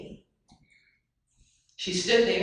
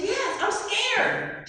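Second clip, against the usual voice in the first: put-on high, slow, timid girl's voice; scared child; 14 semitones higher, much slower than usual. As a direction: draw it out like a frightened little girl. Child admits fear.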